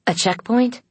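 high-pitched, rising surprised question; startled question. A narrator's reading. Aiden reacting to news of a checkpoint, startled. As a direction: query it in surprise, voice shooting up.

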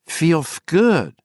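high lisping creepy voice. Acted. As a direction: lisp it, high and eerie. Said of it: "Justin lisped".

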